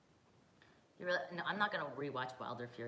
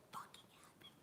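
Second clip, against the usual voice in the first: gleeful whisper; 18 dB quieter than usual. Real, not acted. Celebrating her first podcast.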